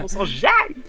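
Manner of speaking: catchphrase in a squeaky put-on voice; silly high voice. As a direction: squeal it like a cartoon.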